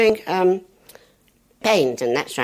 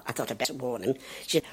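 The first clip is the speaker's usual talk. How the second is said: ordinary talk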